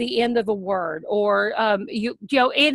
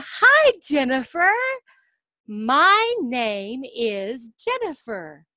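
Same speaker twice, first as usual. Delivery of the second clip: slow model sentence; much slower than usual. Shown as a demonstration. Teacher showing a child the full answer, very slow. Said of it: "And then I say".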